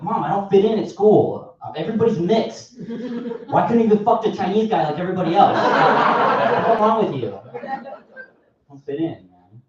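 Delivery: hammed-up sulking child; whiny kid. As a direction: complain to mom like a sulky kid. Whining kid to mom.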